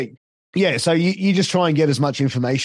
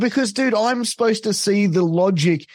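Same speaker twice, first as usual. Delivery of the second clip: exasperated explaining; 6 semitones higher than usual. Retold or imitated. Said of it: "I said".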